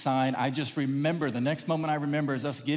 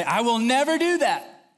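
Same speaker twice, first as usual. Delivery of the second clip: higher, drawn-out declaration; emphatic vow; 7 semitones higher, 4 dB louder, slower than usual. Acted. Preacher mimicking someone swearing something off, emphatic. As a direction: swear it off grandly.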